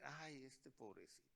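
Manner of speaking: very soft mock pity; hushed, dropping "poor thing" tone; pitying aside. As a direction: sigh it gently, sinking low. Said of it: "si te pregunta Dios tus planes, vas a decir".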